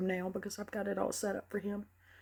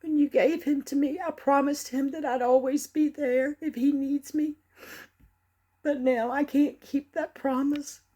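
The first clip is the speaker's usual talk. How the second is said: higher and louder, tearful begging; anguished pleading; 4 semitones higher, 4 dB louder than usual. Acted. Mother begging God to return her son, tearful and loud. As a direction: plead desperately, voice near breaking.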